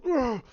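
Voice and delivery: loud, high imitated grunt; effortful grunt. Luke flicking his hand up, loud effort grunt. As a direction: grunt sharply with a Force push. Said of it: "He goes".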